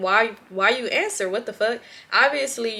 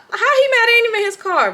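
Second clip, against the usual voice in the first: incredulous; 12 semitones higher, 5 dB louder, faster than usual. Acted. Incredulous over angry boyfriend.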